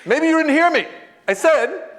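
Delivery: high, loud, emphatic retort; pointed insistence. Retold or imitated. Visitor points at his host, insisting loudly. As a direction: hammer it home, slowing on the last claim. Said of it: "points his finger at me and goes".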